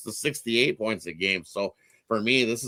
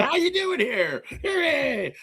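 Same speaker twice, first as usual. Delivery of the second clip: playful self-parody, much higher and stretched; mocking his own "here" habit; slower than usual. Host teasing himself about saying here, in a hammed-up high voice. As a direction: poke fun at your own verbal tic, singsong. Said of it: "I think I say "here" too much".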